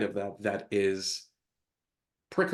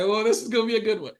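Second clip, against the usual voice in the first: sarcastic dread; 9 semitones higher, 4 dB louder, faster than usual. Retold or imitated. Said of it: "I was like".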